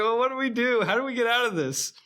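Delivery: high-pitched, fretful pleading; lightly acted helpless fretting; mock panic. Voicing culprits at a loss.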